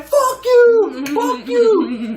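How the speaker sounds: very high, loud screech imitating her; mock furious yell. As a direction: mimic her yelling in a piercing high voice. Acting out her screaming in car.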